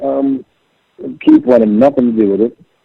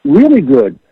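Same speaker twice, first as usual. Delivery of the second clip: high, eager retelling of a friend's enthusiasm; excited insistence; 13 semitones higher than usual. Retold or imitated. Bandmate insisting to his doubtful friend, excited. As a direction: imitate excited insistence, pitch leaping high. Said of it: "I couldn't believe the excitement in his voice".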